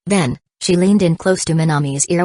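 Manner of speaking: ordinary delivery, nothing marked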